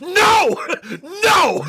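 high wailing no; anguished cry. Acted. Crying out in mock horror.

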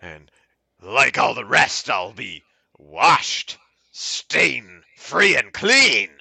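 slow, savoured poetry reading; mock-earnest, lingering verse delivery; poem recital. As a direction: draw out each image as you recite. Reads a poem aloud.

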